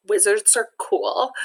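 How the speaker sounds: ordinary delivery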